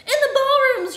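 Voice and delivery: very high, loud shriek; piercing, full-volume cry. A narrator's reading. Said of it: "shrieked Professor Plum".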